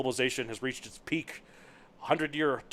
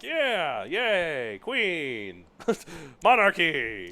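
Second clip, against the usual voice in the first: drawn-out, higher mock cheering; mock monarchist cheer; 5 semitones higher, 4 dB louder, much slower than usual. Imitating his monarchist friend from New Zealand, mock cheering. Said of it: "so he's like".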